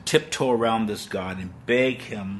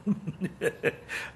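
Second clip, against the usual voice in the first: a soft unvoiced nervous laugh; breathy nervous laugh; 9 semitones higher, 8 dB quieter than usual. A scared believer laughing nervously at God's power. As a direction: laugh under your breath. Laugh inside acted prayer.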